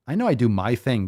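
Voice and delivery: ordinary talk, nothing marked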